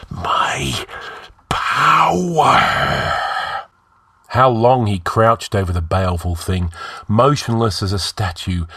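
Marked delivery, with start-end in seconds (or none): 0.1-3.1 harsh whisper swelling into a higher cry; exultant whisper. A narrator's reading. The slave exults over his regained power, whispering fiercely. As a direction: gloat in a drawn-out stage whisper, building. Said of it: "he whispered in terrible exultation".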